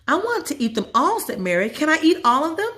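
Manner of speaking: put-on high girl voice begging for all; a kid who wants them all; high eager child voice; greedy little girl.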